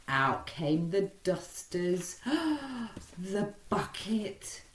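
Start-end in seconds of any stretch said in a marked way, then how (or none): 2.2-3.1 gasp. A narrator's reading. Narrator's acted gasp.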